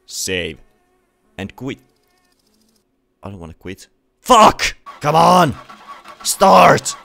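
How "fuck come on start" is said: high, very loud frustrated shouts; furious yelling. Real, not acted. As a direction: scream at the car to start. Car won't start, yelling.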